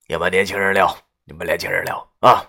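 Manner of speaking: put-on father-in-law loudly addressing the young people, hearty. A narrator's reading. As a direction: say it loudly so the man walking away hears. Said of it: "这几句话声音很大".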